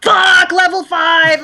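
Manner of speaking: high, loud, drawn-out gamer yell; mock frustrated shout. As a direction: bellow it like a gamer stuck on a level. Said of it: "trying to, like".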